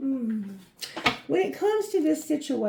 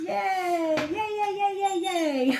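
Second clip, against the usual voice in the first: cheering; 8 semitones higher, slower than usual. Real, not acted. Celebrating reading outcome.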